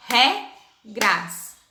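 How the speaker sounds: regras broken into re, pause, gras; split into syllables. Shown as a demonstration. Teacher demonstrating syllable division, regras split as re-gras. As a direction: say regras in two separate beats. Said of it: "vai separar as sílabas".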